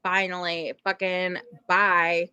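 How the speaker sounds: usual speaking voice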